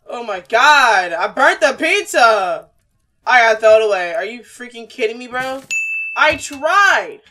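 loud, slowed panic over burnt pizza; panicked outburst. Real, not acted. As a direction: freak out loudly.

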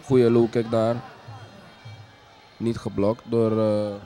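ordinary talk

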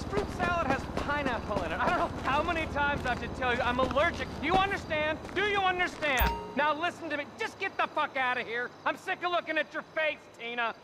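angry diva. Acted. Diva actress berating assistant.

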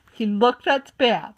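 plain reading voice

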